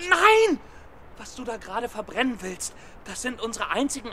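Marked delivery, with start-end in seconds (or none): 0.1-0.6 loud high cry of no; desperate no. Acted. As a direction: cry out to stop her. Stopping her burning the papers. (verzweifelter lauter Ausruf)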